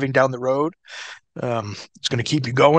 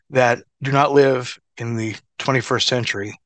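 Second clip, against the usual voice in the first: ordinary talk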